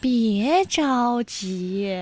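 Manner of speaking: very slow and soft; sloth. Pretend. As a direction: drag it out like a sloth. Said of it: "用树懒的方式来说话".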